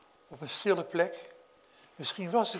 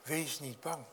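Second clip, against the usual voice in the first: much softer, almost hushed; soft repeat; 2 semitones lower, 8 dB quieter than usual. Acted. Preacher echoing Jesus' comfort to the listeners, hushed. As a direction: say it again gently, to the congregation.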